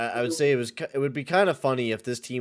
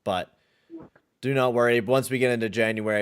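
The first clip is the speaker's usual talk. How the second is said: ordinary delivery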